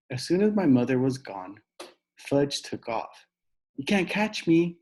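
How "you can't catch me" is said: hollered. Acted. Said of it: "he hollered".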